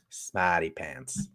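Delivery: put-on slow, low, soft Boston accent. Host reading viewer names, struggling at a Boston accent. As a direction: attempt a drawn-out Boston accent. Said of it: "Boston is hard".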